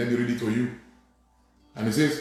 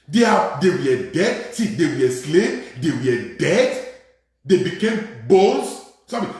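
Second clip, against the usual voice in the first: rhythmic, insistent pulpit repetition; emphatic preaching; 6 semitones higher, 5 dB louder than usual. Real, not acted. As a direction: hammer out each short phrase to the congregation. Preaches with repeated phrases.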